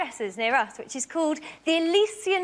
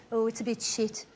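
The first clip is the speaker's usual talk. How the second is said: unimpressed local; 6 semitones lower, 5 dB quieter than usual. Retold or imitated. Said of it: "And they all go".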